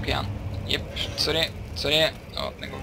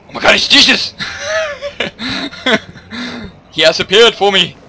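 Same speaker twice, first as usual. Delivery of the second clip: excited shout; 9 semitones higher, 9 dB louder than usual. Pretend.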